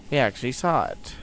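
usual speaking voice

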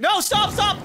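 high, loud cry to stop; alarmed call. Real, not acted. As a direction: call out urgently at the screen. Calling out at the clip.

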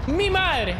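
high, sudden outburst; exclamación de asombro. Real, not acted. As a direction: burst out loudly as if taken aback. Reacciona a la burla del chat. (exclamación aguda de sorpresa)

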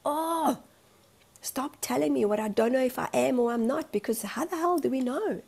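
retold fed-up protest, pitch jumping up; exasperated outburst. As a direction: act out snapping at a nosy questioner. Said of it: "want to throat punch them and just go".

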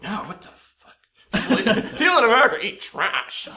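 high, loud laughing outburst; mock indignant. Mocking reply to tourists about trash, high loud laughing voice. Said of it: "I'm like".

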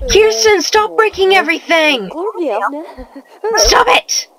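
fed-up scolding at a clumsy Sim; exasperated scolding. Real, not acted. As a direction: scold her like a fed-up parent. Scolding a Sim breaking things.

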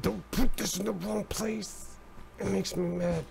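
high, soft prissy roommate impression; lightly mocking roommate warning. Roommate voiced in story.

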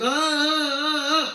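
high, drawn-out wailing "aah"; mock yell held on a high note; imitated prayer shouting. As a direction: join the crowd with a long wordless cry. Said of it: "יאללה, גם אני צועק".

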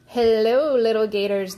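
swagger like a gangster; sneering gangster gators. A narrator's reading. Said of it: "they said with a sneer".

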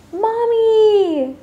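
high, loud, long-held shout; child's excited, stretched-out call; joyful cry. A narrator's reading. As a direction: squeal for Mom coming home. Child greets returning mother.

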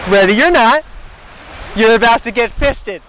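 loud, slow poem recital. Pretend. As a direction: recite it like a big reveal.

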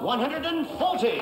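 darts score caller. Real, not acted.

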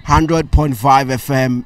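high, loud host greeting; energetic radio-style self-introduction; podcast intro. Acted. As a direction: open the show big and loud. Podcast host opens the show.

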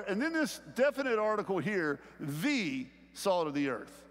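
normal talking voice

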